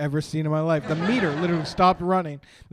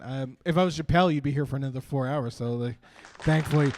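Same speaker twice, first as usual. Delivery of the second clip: ordinary delivery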